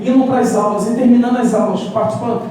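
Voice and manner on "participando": ordinary delivery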